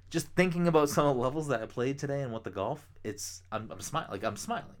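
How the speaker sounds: talking through a grin; smiling voice. Real, not acted. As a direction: let the smile show in the voice. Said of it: "hear the smiles in my voice".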